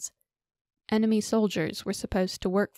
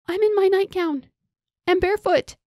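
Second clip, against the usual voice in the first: outraged; 12 semitones higher, 6 dB louder than usual. Acted. Woman outraged at night intruder.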